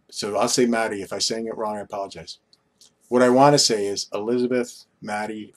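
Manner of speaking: usual speaking voice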